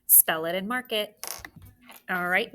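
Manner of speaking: ordinary delivery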